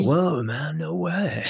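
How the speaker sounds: high, airy Valley surfer dude drawl; stoned California Valley surfer, amazed; California Valley accent. Shown as a demonstration. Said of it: "California Valley".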